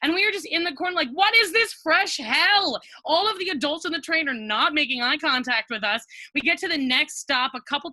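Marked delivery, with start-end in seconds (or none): 1.1-2.8 higher and louder, slowly drawn out; exasperated disbelief. Retold or imitated. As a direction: stretch each word out in mock despair. Cornered while being kicked.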